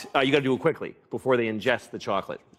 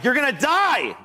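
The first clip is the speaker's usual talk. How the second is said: much higher frantic cry; panicked yell; 10 semitones higher than usual. Retold or imitated. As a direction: scream it at the dog in panic. Yelling at the dog.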